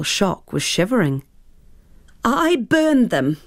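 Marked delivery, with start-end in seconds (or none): 2.2-3.4 anguished cry. Acted. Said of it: "she cried".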